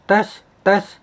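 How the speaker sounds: acted-out microphone test; mic check. Shown as a demonstration. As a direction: call out a sound check. Acts out a microphone level test.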